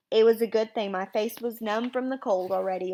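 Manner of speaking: ordinary delivery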